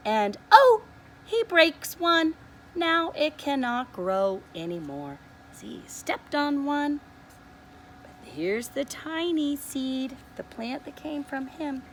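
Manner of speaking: ordinary delivery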